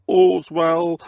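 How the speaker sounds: a woman on the cart calling to onlookers. Acted. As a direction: call it out down the road. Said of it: "the woman would call out".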